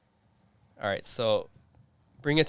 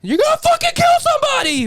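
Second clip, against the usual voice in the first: high screaming chef, voice cracking; voice cracking yell; 5 dB louder than usual. Retold or imitated. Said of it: "his voice cracks and all that".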